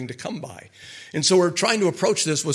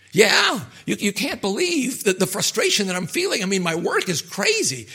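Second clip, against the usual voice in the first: high, worked-up venting about work; frustrated patient venting to a therapist, high and worked up; agitated client; 6 semitones higher, 3 dB louder than usual. Pretend. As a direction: pour out the frustration in a rush.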